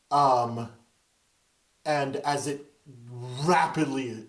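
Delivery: normal talking voice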